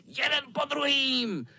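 very high, piping cat threat; high villain squeal; squealing tomcat. Acted. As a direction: squeak it out menacingly. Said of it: "pištěl kocour".

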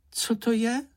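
high little curious voice; curious question. A narrator's reading. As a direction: ask it high, like a curious small creature. Curious little Čenich asks. (zvědavě, vysokým hláskem)

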